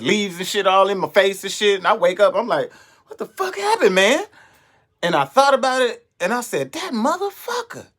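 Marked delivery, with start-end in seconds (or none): 3.1-4.2 high, dazed what-happened cry; dazed disbelief. Retold or imitated. Waking up in the leaves, high dazed disbelief. Waking up after being knocked out. 6.7-7.9 incredulous realization. Retold or imitated. Realizing who hit him.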